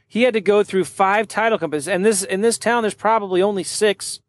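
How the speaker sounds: normal talking voice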